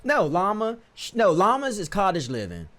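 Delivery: normal talking voice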